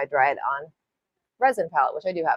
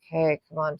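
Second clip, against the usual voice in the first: usual speaking voice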